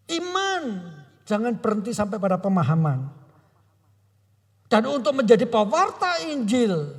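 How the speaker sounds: high, loud, impassioned sermon climax; preaching at full force. Acted. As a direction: preach it out, pressing every phrase.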